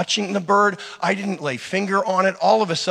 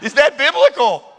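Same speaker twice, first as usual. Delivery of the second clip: high, loud incredulous laugh-question; shocked delight at the bird flying off; astonished; 9 semitones higher, 4 dB louder than usual. Retold or imitated. Preacher retelling the bird reviving, astonished high laughing question. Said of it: "and I'm like".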